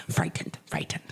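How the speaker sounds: "frightened" twice, softly, carefully pronounced; overemphasized FRIGHTENED. Retold or imitated. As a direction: repeat "frightened" softly but pointedly. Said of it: "She, like, overemphasizes".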